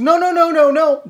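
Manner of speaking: high, insistent string of refusals; flustered panic. Retold or imitated. Embarrassed neighbor refusing the boys' help, high and frantic. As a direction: mimic the neighbor desperately refusing.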